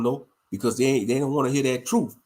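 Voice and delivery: usual speaking voice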